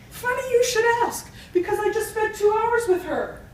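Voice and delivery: acted rabbi. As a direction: put on the rabbi, pitched well up. Rabbi answers the eager husband.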